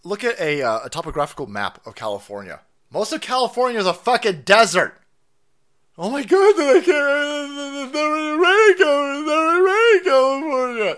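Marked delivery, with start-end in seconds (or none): 2.9-5.0 annoyed rant. Real, not acted. Angry rant at climate clip. 6.0-11.0 high, loud, whiny put-on voice; sarcastic mock whine, way up high; mock whiny local. Mocking Californians' complaints.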